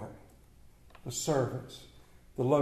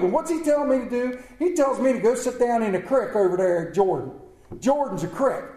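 indignant rant; 4 semitones higher, 6 dB louder than usual. Acted. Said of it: "So Naaman throws a fit".

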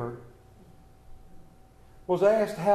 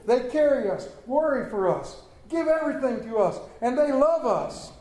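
high, forceful, driving exhortation; impassioned preaching; 6 semitones higher than usual. Acted. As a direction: preach it out with raised pitch and full conviction.

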